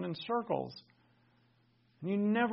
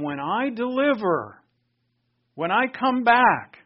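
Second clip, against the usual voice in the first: higher, louder, ringing out each phrase; raised preaching voice, drawn out at the peak; emphatic divine promise; 6 semitones higher, 8 dB louder, slower than usual. Acted. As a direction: proclaim it as the Lord, building on the second phrase.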